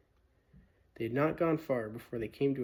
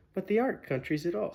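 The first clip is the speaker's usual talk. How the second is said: almost laughing; 8 semitones higher, 7 dB louder than usual. A narrator's reading. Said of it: "almost laughing".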